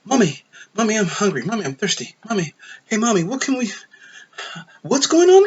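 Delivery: mocking a childish hero asking mommy for everything; childlike voice. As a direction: play a needy small child calling for mommy. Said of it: "he was just so kid-like".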